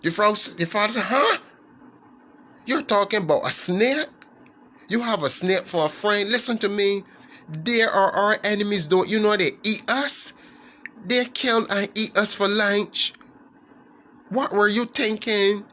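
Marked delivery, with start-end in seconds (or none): 1.0-1.4 shocked huh. Acted. Father shocked at snake friend. 2.6-15.6 raised, urgent fatherly warning; alarmed scolding father. Acted. Father frog scolding his son about the snake friend, alarmed and raised.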